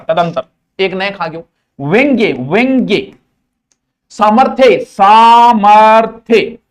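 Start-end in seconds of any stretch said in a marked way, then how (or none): 4.1-6.6 सामर्थ्य stretched very slowly and said louder; emphatic drill. Shown as a demonstration. Teacher hammering a word for students, slow loud drill. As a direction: lean hard on सामर्थ्य, drawn out long. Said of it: "drilling a word".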